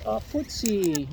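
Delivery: ordinary talk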